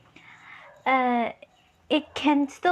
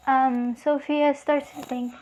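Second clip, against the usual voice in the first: ordinary delivery, nothing marked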